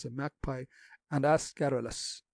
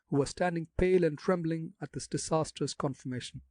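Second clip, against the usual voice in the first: ordinary delivery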